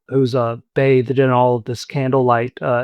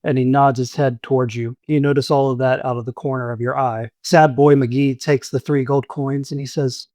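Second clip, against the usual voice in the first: ordinary talk, nothing marked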